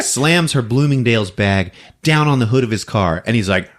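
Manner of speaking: ordinary talk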